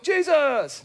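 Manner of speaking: high, sing-song answer of Jesus; playful high-pitched guess; mock Sunday-school answer. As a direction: chirp it like the obvious kid answer. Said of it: "I'm surprised you didn't go like".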